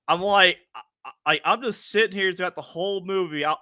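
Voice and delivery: ordinary talk, nothing marked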